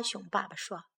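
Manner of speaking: usual speaking voice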